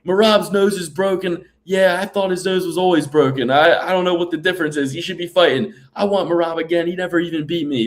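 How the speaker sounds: high, insistent promo voice; mock fighter promo. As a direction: make your case for a rematch. Acts out suggested fighter promo.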